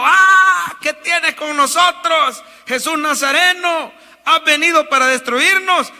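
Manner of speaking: high, loud possessed shriek, slowing on the question; demon screaming. A narrator's reading. Preacher voicing the demon crying out in the synagogue, loud and high. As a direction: scream it out as the demon cornered by Jesus. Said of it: "empezó a gritar diciendo".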